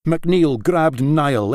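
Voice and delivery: usual speaking voice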